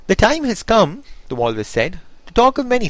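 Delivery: high, loud proclaiming walrus; grand walrus voice. Acted.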